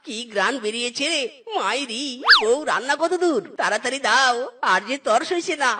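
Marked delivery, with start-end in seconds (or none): none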